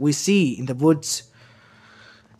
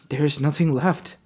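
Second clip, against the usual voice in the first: panicking at his own body; rising panic; 3 semitones higher, 3 dB louder than usual. Acted. Naruto sees his new body.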